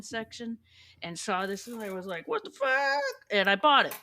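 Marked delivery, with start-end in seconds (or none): 2.3-3.0 shocked exclamation. Retold or imitated. Finding the record in the N section, a high startled outburst. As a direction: pitch jumps much higher on a stunned what the fuck. Spotting the album in the store.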